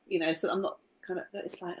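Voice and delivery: normal talking voice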